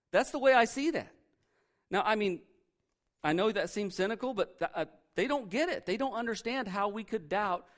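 usual speaking voice

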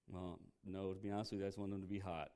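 low, quiet, reluctant reply; mock-sheepish confession under the voice; sheepish admission. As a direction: own up quietly, dropping the pitch and volume. Acting a person's sheepish answer.

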